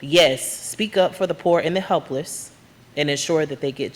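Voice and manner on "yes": ordinary talk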